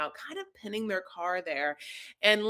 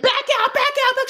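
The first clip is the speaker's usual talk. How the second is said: much higher, louder frantic shouting; panicked yelling; 12 dB louder, faster than usual. Retold or imitated. Husband yelling at new driver wife, frantic shout. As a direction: scream the orders in a panic.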